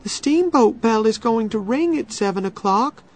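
put-on high, urgent girl's voice; anxious girl. A narrator's reading. Becky urging Tom to head back for the steamboat, anxious. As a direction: press him anxiously, pitched high.

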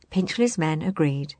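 ordinary delivery, nothing marked

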